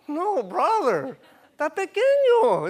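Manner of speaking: high-pitched, exclaimed mock protest; emphatic objection. Preacher telling a brother his idea of God is small, exclaimed protest. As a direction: object loudly, pitch soaring at the end.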